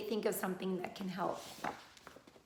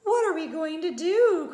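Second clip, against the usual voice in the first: louder, higher anguished cry; mother crying out, louder and higher; 6 semitones higher, 9 dB louder than usual. Acted. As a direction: wail it in despair. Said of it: "cried Missus Cream".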